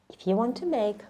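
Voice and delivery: ordinary talk, nothing marked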